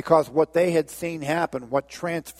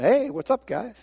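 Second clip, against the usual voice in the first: casual [name] greeting; 8 semitones higher than usual. Acted.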